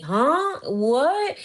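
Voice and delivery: high, rising confused questions; acted-out baffled double take; mock bewilderment. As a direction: imitate someone caught totally off guard. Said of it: "Lisa looking at him like".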